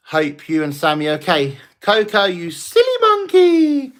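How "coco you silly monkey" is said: much higher, louder, slow sing-song; silly monkey tease. Pretend. Streamer calling a viewer's pet on request, high loud sing-song. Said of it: "Can you call Coco a silly monkey?".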